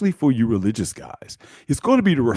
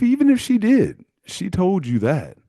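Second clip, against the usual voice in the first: ordinary talk, nothing marked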